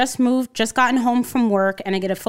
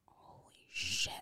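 slow, breathless whispered shock; stunned whisper; 12 semitones lower, 19 dB quieter, slower than usual. Retold or imitated. Learning the caller is her sister, slow stunned whisper. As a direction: let it out on a whisper, floored.

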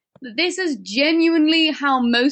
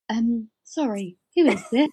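Charlotte. Retold or imitated. Line manager interrupting elves on the radio, mock bewildered. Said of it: "Charlotte would be like".

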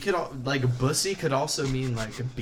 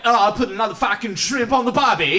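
loud, high mock-Australian rant; hammed-up Australian freak-out; 8 semitones higher, 7 dB louder than usual. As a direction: shout it like a manic Aussie cartoon character. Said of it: "And he, like, freaks out".

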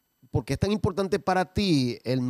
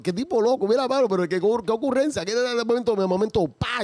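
amused exclamation; 9 semitones higher than usual. Retold or imitated. Retold laughing reaction to comedian.

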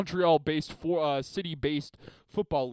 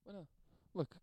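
usual speaking voice

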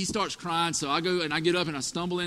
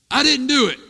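put-on loud, high defensive kid denial; child denying; 6 semitones higher, 10 dB louder than usual. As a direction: blurt it out like a guilty little boy.

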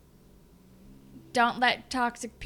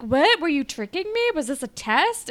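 high, loud mock outrage; a girl realizing she was tested, hammed-up and high; mock shocked; 7 semitones higher, 6 dB louder than usual. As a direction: play her caught off guard, higher and louder. Retold shocked accusation.

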